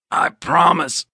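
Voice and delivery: high, strained little squeak; choked, high-pitched squeak. A narrator's reading. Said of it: "strained, barely audible squeak".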